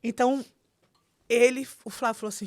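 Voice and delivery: ordinary talk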